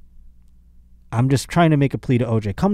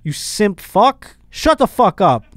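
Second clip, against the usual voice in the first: mock insult; 8 semitones higher, 5 dB louder, slower than usual. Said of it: "I would have been like".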